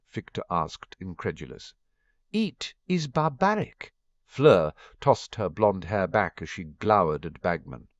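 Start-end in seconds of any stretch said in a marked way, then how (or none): 2.3-3.9 put-on French accent. Fleur, French champion, objecting.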